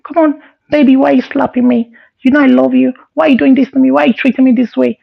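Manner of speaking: put-on high, whiny girly pleading. Slapped friend whines back in a high girly voice. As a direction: whine like a hurt girlfriend. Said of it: "He was not talking like a girl".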